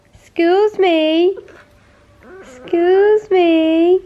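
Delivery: slow, drawn-out high coo. Real, not acted. Drawn-out baby talk.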